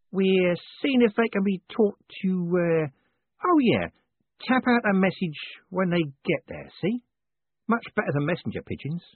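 high, halting character voice; high voice, stalling then suddenly remembering; shifty man improvising. Acted. Voices shifty third pigeon fancier.

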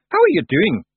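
high, loud breezy greeting; cheery and punchy; cheerful. A narrator's reading. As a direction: greet him like an old mate, in Scots. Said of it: "said Rob Anybody cheerfully".

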